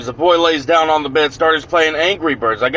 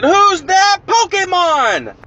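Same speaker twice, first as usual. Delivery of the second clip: high, drawn-out cartoon TV call; Pokemon TV announcer; much slower than usual. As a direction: imitate the show's drawn-out catchphrase. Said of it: "I heard on the T V it said".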